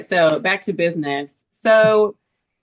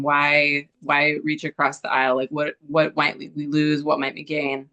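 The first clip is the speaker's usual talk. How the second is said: usual speaking voice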